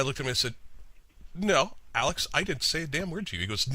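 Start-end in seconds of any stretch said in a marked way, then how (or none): 1.3-3.4 high-pitched protest of innocence; protesting retold denial. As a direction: act out an emphatic denial.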